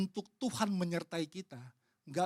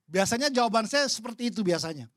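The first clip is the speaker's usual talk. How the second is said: normal talking voice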